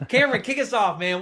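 calling out high and loud to kick things off. Real, not acted. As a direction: hype him up with a big shout. Host hands over to guest.

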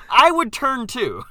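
high, loud, drawn-out admission; slow it down and push it high and loud; mock exasperation. Joking about staring at a giant, mock exasperated.

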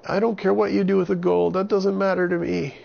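put-on very high, loud frightened boy; scared boy voice. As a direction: plead like a panicked little boy. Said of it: "And Avon said".

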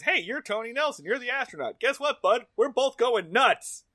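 high, chirpy retold breakdown; loud, high, cheerfully unhinged; manically upbeat. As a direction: greet a fellow madman with gleeful energy. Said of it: "He's very upbeat about his nervous breakdown".

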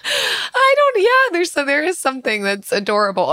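high squeal, then gushing; overwhelmed by cuteness. Real, not acted. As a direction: squeal like it's too adorable. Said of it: "your cute aggression face".

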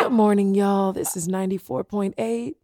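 smooth radio DJ sign-on; morning radio host. Pretend. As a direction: play the radio host, stretching the station name. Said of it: "go do my morning show".

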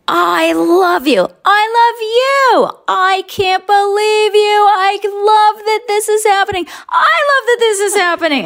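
high, sustained mock squealing; big, over-the-top gushing. Overheard stars freaking out meeting each other, big high gushing. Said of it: "they're just being big on purpose".

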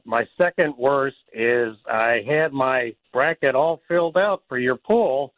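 ordinary delivery

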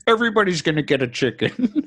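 high, laughing put-on politician voice; mock a pompous promise while cracking up. Mocking Bill Maher, laughing.

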